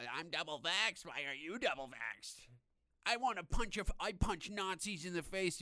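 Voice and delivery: high, loud whiny mock rant; hammed-up angry leftist. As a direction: sneer it like a shrill activist. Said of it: "leftist fucking losers yelling at you".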